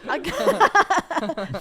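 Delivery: words lost in loud, high laughter; laughing. Real, not acted. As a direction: crack up mid-word. Cannot finish reading for laughing.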